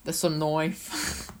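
hammed-up Aussie movie quote; Australian accent. Shown as a demonstration. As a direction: do a Crocodile Dundee Australian accent. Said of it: "do an Australian accent".

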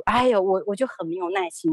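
normal talking voice

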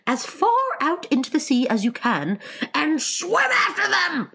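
excited yell climbing to a scream. Acted. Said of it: "screamed the Gryphon".

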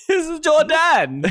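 put-on high, laughing German accent; playful German tourist voice.

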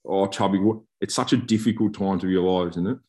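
ordinary talk, nothing marked

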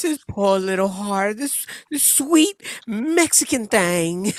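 drawn-out, hammed-up pity; gushing sympathetic customer. Customer pitying the poor carrier to partner, mock gushing.

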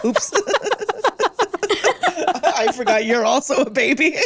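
giggling through the words; laughing. Real, not acted. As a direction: laugh while you say it. Teller laughs through the quote.

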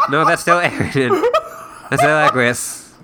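real laughter breaking into the words; laughing. Real, not acted. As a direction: crack up at your own failed attempt. Cracks up over failed accent attempts.

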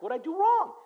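high retold puzzled question. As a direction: ask it genuinely baffled. Pastor puzzled by critic.

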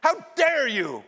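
high, loud acted indignation; mock outrage. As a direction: scold a king in hammed-up outrage. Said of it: "The first thing I would say out and be like".

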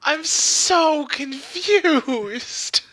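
drawn-out confusion. Real, not acted. Co-host drawls out confusion.

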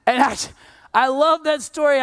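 usual speaking voice

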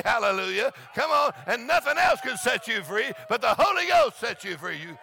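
high, loud, shouted preaching; preaching at full force. Acted. Preacher rousing the church about joy, shouting. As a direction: preach it out to the whole congregation.

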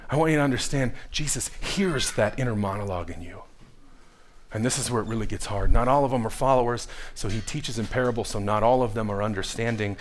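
low, soft, earnest preacher's voice; hushed preaching. Acted.

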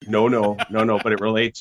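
loud, emphatic protest; really loud. Real, not acted. Guest insisting it relates directly, really loud. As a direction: cut in loudly, insisting. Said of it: "Is he really loud".

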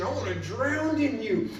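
high, loud, impassioned plea; fervent cry to God. Retold or imitated. Worshiper surrendering to God, crying out fervently. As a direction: plead with God at full heart, stretching the words. Re-enacts his passionate prayer to God.

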